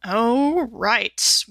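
normal talking voice

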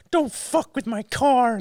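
high, slow mock warning; a light, drawn-out put-on voice; Jordan Peterson. Guarding his car from anyone, lightly acted high-pitched warning. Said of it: "I'm like Jordan Peterson".

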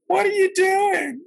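very high, slow, thin little voice; a big woman's squeaky tiny voice asking; tiny high teacher voice. Retold or imitated. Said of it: "she had a really little bitty voice".

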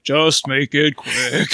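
slow, resigned, mopey drawl; gloomy Eeyore voice. Pretend. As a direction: sound utterly defeated and glum.

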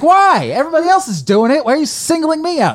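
high, loud, fast mock outrage; indignant retelling. Retelling arguing with security about smoking, mock outrage. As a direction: replay your own whiny protest.

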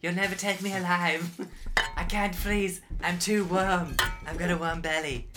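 high loud defiant yelling; hammed-up arrest. Spooky resisting arrest, yelling at the police, hammed-up. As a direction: ham it up fighting off the cops.

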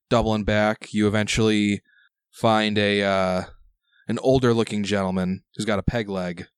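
usual speaking voice

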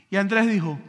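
ordinary talk, nothing marked

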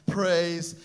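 loud and very slow, each word drawn out; with an exclamation mark. Shown as a demonstration. Pastor reading Psalm 150's end, loud and slow. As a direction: shout it out as the psalm's final cry. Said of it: "exclamation mark".

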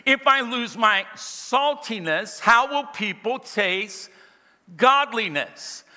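slow, weighty reading with spaced phrases; park on every phrase, letting it sink in. Shown as a demonstration. Preacher repeating the verse for the congregation, slowed down. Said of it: "I'm gonna slow down".

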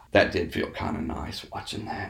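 whispered, heavy breathing after a rant; breathy whisper. Real, not acted. Guilty pleasure about the crash, whispered breathlessly. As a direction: let it out in a worked-up whisper. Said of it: "God, you're breathing so heavy".

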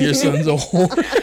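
slow, laughing taunt; laughing mock insult. Co-host joining the joke on the mother, laughing slowly.

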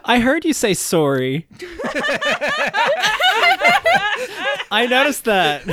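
laughing through the words; giggling while teasing. Real, not acted. Teasing laugh at accent slip.

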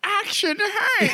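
high, drawn-out cartoon hero call; Action Hank. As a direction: attempt a cartoon hero accent, stretched out. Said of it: "I can't do the accent".

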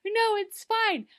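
Regina brushing it off. Retold or imitated. Imitating Regina waving off Daniel's worries in a high voice. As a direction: squeak out a breezy brush-off. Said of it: "She's like".

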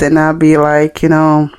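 ordinary talk

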